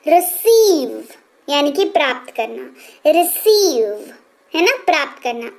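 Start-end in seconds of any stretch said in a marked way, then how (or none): 0.1-1.0 "receive" with weight on a long सी; stressed long ee. Shown as a demonstration. Teacher demonstrating "receive" with force on the ee. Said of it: "अब थोड़ा सा जोर डाल करके करेंगे". 3.1-4.1 "receive" very slow, सी stretched out; stressed long ee. Shown as a demonstration. Teacher repeating "receive" for students, ee drawn out. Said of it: "जोर डाल करके करेंगे इसको".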